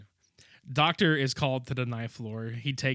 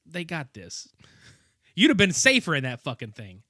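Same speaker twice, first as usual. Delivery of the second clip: usual speaking voice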